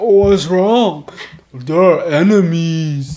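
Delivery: just woken and confused; sleepy giant head. Acted. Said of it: "the other head is slowly waking up".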